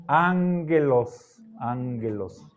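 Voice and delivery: ángelos with the first gamma as an n sound, angelos; ángelos, nasal ng then soft g; correct pronunciation. Shown as a demonstration. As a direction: say ángelos with an ng before the g.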